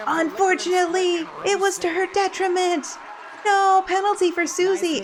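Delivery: high, pained dismay; dismayed groan. Real, not acted. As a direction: wince at the penalty. Dismay at a penalty.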